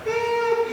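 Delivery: high, soft mock whimper; thin, high pretend sob; imitated crying whimper. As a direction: whine through closed lips like a put-on cry. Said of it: "cae y se pone a llorar".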